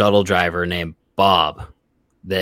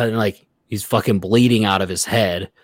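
normal talking voice

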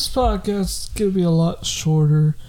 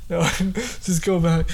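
ordinary talk

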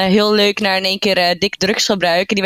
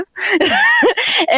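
high laugh; 13 semitones higher than usual. Real, not acted. Laughs at own remark.

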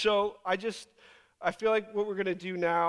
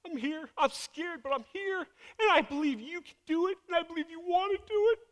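high-pitched, fervent prayer; heartfelt declaration; 9 semitones higher than usual. Acted. Scared child of God praying to Dad, fervent and high. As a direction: pour out trust to a father, voice lifted high. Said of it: "He just wants hearts that say".

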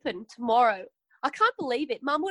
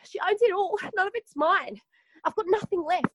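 mock despair; 8 semitones higher than usual. Despairing that Shirley took everything.

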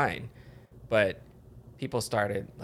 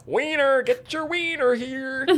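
high, loud street vendor; vendor call; 13 semitones higher, 4 dB louder, slower than usual. Pretend. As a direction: hawk it like a ballpark vendor. Hot dog vendor call.

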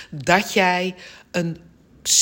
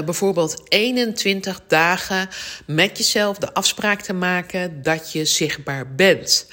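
usual speaking voice

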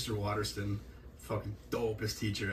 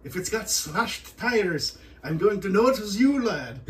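high, drawn-out Scottish threat, getting louder; Scottish accent; 8 semitones higher, 6 dB louder, slower than usual. Retold or imitated. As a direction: imitate a Scottish teacher warning a boy. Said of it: "off-the-cuff Scottish".

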